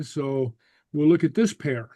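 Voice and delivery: ordinary talk, nothing marked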